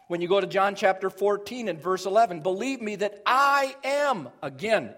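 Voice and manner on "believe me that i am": raised, weighty stress on "I"; emphatic I. Shown as a demonstration. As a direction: build up to a strong "I am". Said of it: "emphatic pronoun".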